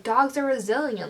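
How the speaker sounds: normal talking voice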